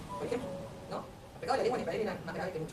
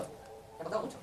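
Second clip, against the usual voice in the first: softer, sinking the point in; softly repeated; 7 dB quieter, slower than usual. Real, not acted. Preacher echoing his own words to the congregation, softer. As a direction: let it drop to a near hush.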